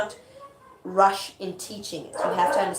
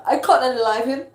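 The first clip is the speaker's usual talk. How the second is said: loud mock-dramatic protest; overplayed stage whine; 3 semitones higher, 7 dB louder than usual. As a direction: ham it up as a reluctant killer. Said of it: "no, I'm being dramatic".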